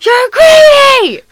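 furious high shout, dragged out slowly; angry yell. Retold or imitated. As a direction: bellow it at a kid like an angry Santa. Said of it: "described Santa yelling".

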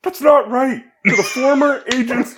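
Winston voice gone Obama. Acted. Friend reads Winston lines for impression game, breaking into laughs. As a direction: try Winston but slide into Obama cadence, giggling. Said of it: "I went very Barack Obama".

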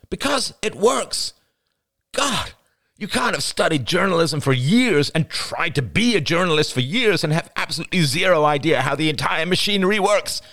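high-pitched, heated tirade; exasperated outburst. Acted. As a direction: let the frustration burst out at a partner. Acted quarrel, exasperated outburst.

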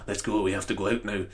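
low, quiet action-hero voice; Scottish Liam Neeson. Acted. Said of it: "He's not Scottish Liam Neeson".

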